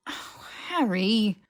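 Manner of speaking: slow, exasperated whispered groan. Acted. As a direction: groan his name, exasperated. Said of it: "she groaned".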